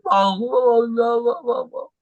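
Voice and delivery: high, wailing imitation of someone crying out; mock crying. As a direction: exaggerate a tearful plea to God. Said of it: "nangis gitu". (pura-pura menangis meratap)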